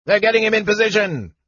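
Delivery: high, fast gnome yell. A narrator's reading. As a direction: yell it out quickly. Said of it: "Nosh yelled".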